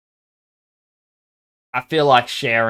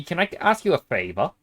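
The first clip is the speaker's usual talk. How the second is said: ordinary talk, nothing marked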